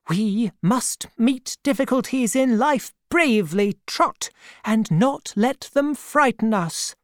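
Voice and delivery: put-on steady the boy, brisk and resolute; firm old aunt. A narrator's reading. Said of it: "looked firm".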